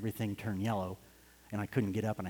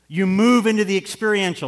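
much higher and louder, preaching; fervent shout; 8 semitones higher, 13 dB louder than usual. Real, not acted. Preacher exhorting the congregation at full force. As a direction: ring it out to the rafters.

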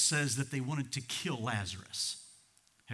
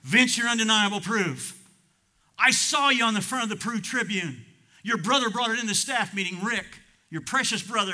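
high, loud sermon delivery; impassioned, raised pulpit voice; full-force preaching; 8 semitones higher, 8 dB louder than usual. Real, not acted. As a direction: proclaim it to the back pew. Preaches directly at a congregant.

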